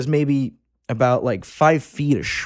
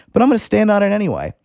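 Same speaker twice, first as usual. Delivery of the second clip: defiant quip; 8 semitones higher, 3 dB louder, faster than usual. Retold or imitated. Defiant retort, fast high.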